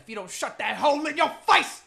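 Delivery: retold angry yell. Man on the escape boat silencing a woman, mock angry yell.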